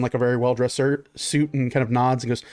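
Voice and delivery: ordinary delivery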